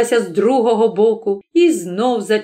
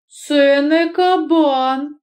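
higher, drawn-out amazement; astonished; 6 semitones higher, much slower than usual. A narrator's reading. Godfather staring at what is in the sack, astonished. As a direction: draw it out in wide-eyed surprise. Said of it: "сказав кум і випулив очі".

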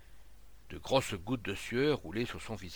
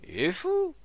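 very high, loud cry of disbelief; startled exclamation; 7 dB louder than usual. A narrator's reading. Onlooker to the crowd about Rodiguet, startled cry. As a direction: exclaim it in shock.

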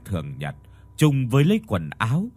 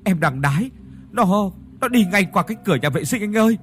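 panicked, high and loud, rushing the end; stammering curse; 8 semitones higher, 3 dB louder, faster than usual. A narrator's reading. Terrified friend reporting the ghost, stammering curses. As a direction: curse in terror, words tumbling out. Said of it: "mồm lắp bắp chửi thề".

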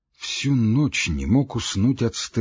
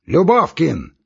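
loud, high summons; sudden loud shout; 7 semitones higher, 6 dB louder, slower than usual. A narrator's reading. Postmaster calling his clerk, sudden loud shout. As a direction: bark out the name. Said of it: "крикнул он так громко".

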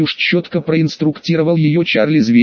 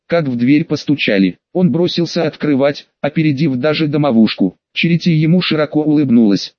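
ordinary delivery, nothing marked

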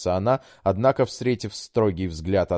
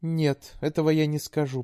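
put-on high, pleading young woman; daughter voice; 10 semitones higher than usual. A narrator's reading. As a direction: coax like a sweet daughter.

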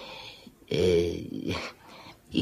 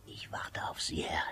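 a conspiratorial whisper, much softer; 3 semitones lower, 14 dB quieter than usual. Acted. Landlord tempting the drunk lodger, conspiratorial whisper. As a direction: lean in and breathe it like a secret.

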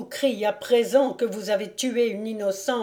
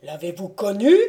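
usual speaking voice

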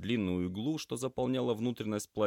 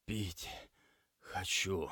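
weak, halting croak; faint, broken-up words; hoarse voice; 5 dB quieter, much slower than usual. A narrator's reading. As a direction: plead feebly, as an injured patient. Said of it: "парень хриплым голосом".

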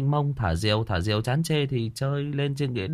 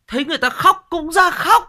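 high, loud comic whine; mock wailing retelling; 9 dB louder than usual. Storyteller recalling kids who cried along at funerals, mock wailing. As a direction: pitch it way up and loud, half wailing.

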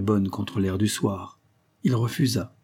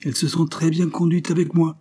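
higher, raised voice of reason; 8 semitones higher, 5 dB louder than usual. A narrator's reading. Representative shields the women from his soldiers, reasoning firmly. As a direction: firmly talk the men down. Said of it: "disant d'un air de raison aux soldats".

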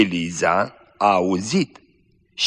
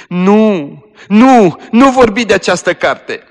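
high, loud frantic refusal; dying girl shouts the cousin down, high and loud; desperate shout; 11 semitones higher, 8 dB louder, slower than usual. Acted. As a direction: shout her down in panic.

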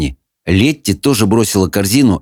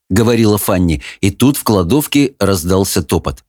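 usual speaking voice

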